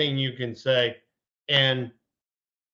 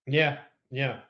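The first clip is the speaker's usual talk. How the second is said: ordinary delivery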